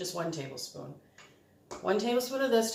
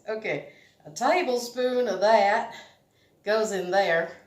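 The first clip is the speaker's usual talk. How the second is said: louder, slower hammed-up southern voice; mock southern accent; 3 semitones higher, 7 dB louder than usual. Home baker hamming a southern accent while adding molasses. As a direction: play the southern cook in disguise. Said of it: "So I'm in disguise".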